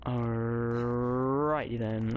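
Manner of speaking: very slow, stretched first word; playfully elongated catchphrase; drawn-out alrighty. Real, not acted. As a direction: roll out a long "Alrighty" before moving on.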